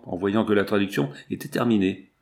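usual speaking voice

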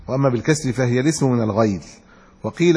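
normal talking voice

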